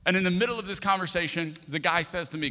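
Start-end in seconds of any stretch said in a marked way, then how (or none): none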